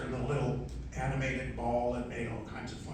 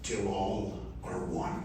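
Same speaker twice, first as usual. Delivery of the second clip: lower, slow, solemn commander voice; deep heroic robot leader; 6 semitones lower, slower than usual. Shown as a demonstration. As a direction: deliver it like a noble robot leader. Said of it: "here's a good example of it".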